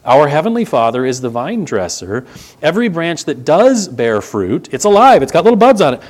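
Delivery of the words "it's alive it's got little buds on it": higher and louder, pressing the point home; emphatic insistence. Real, not acted. Preacher insisting to the church the branch lives, emphatic. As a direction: drive it home with energy.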